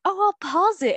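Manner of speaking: high quoted mom voice. Retold or imitated. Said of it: "she's like".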